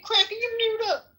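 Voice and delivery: high mimic voice. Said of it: "Your voice is gonna stay like that".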